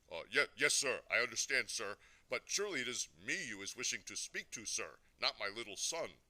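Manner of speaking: loud, stammering, over-eager obedience; a flustered father saying sir too often; flustered deference. A narrator's reading. Father to a very important caller, flustered deference.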